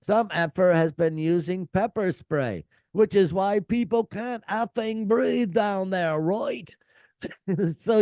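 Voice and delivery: hammed-up scolding of the crowd from the stage; imitating an annoyed singer telling off fans. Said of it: "[name] can be heard saying".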